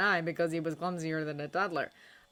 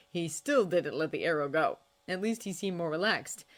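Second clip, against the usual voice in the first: usual speaking voice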